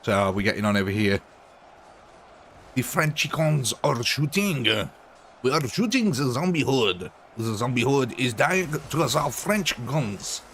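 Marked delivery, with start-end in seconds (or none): 2.7-10.4 slow, hammed-up French accent; a gamer mocking a bad French accent. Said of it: "That was my impression".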